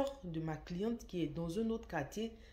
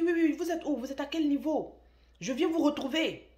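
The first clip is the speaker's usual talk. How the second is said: much higher, loud threatening yell; imitating furious husband; 9 semitones higher, 8 dB louder than usual. Husband threatens to come find the brother, loud furious yell. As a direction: bark the threat like a jealous husband. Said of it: "Et il commence à crier".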